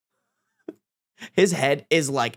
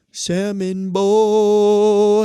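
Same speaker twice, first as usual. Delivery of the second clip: very slow, louder drawn-out call; sing-song treat call; 5 semitones higher, 5 dB louder, much slower than usual. Real, not acted. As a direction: stretch it out to tempt the cat. Offering cat a treat.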